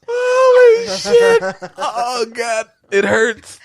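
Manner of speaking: sudden high, loud yelp, then slow groaning words; real shock after getting hurt, drawn out; startled cry of pain. Real, not acted. Host jolted by a sudden hit, crying out in pain. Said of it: "What just happened?".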